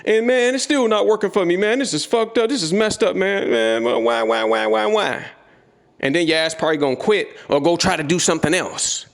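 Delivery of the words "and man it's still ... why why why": higher, whining self-pity; the inner bitch complaining that trading won't work; whiny quitter. Retold or imitated. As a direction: whine like a beaten quitter who wants to give up. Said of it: "The bitch is gonna say".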